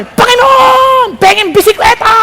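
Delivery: put-on high, drawn-out child's yell to God; child shouting. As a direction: yell it like a kid begging for a bike. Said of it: "Sigaw na sigaw eh". (batang sumisigaw)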